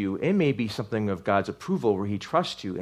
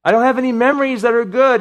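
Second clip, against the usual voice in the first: loud, high, pained psalmist; anguished cry; 12 semitones higher, 10 dB louder than usual. Acted. Preacher voicing the suffering psalmist, loud and anguished. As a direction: cry it out like a man in endless pain. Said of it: "to him it seems like an eternity".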